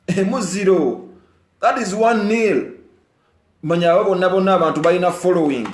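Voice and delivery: loud, high, rousing delivery; rally the listeners with heat; fired-up speech. Real, not acted. Singer rousing fans about [name]'s influence, loud and fired up.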